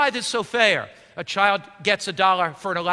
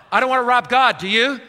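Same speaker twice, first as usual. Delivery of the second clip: raised, ringing appeal; loud appeal; 5 semitones higher, 7 dB louder than usual. Real, not acted. Preacher appealing loudly to the congregation.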